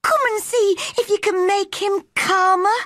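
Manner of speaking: very high-pitched anxious maid; panicky pleading. Acted. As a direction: beg urgently in a very high voice. Martha pleads with Mary for help.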